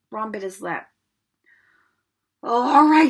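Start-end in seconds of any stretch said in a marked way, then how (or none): 2.4-3.1 irritated, higher and much louder; snapped. A narrator's reading. Friend grudgingly agreeing to go along, snappish. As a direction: give in with an exasperated snap. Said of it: "he snapped".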